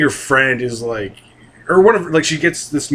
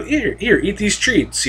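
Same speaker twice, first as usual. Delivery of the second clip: put-on coaxing girl; 6 semitones higher than usual. Girl coaxing dying cat.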